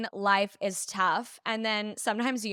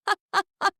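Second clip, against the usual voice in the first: mock villain cackle, higher and louder; villain laugh; 9 semitones higher, 4 dB louder than usual. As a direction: laugh like a cartoon villain over the phone. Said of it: "He sounds like a villain".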